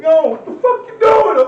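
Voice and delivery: high, loud, slowed outraged yell; outraged shout. Retold or imitated. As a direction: yell it at her in disbelief. Retelling shouting at girlfriend.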